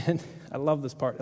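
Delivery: usual speaking voice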